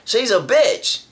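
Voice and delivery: retold loud reply. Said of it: "he kinda smiled and he said".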